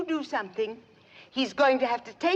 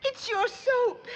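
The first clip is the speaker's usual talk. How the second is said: high, slow tearful wail; hammed-up weepy whine; bawling; 11 semitones higher, slower than usual. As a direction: wail it out through tears. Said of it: "what's got you to bawling".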